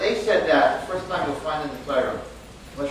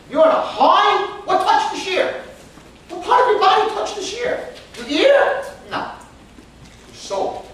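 fired-up preaching; 6 dB louder than usual. Real, not acted. Rousing point about the shiur.

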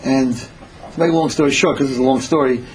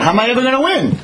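exasperated protest; 9 semitones higher, faster than usual. Acted. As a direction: voice climbing higher, rushed, in dismay. Son protests his tiny kayak.

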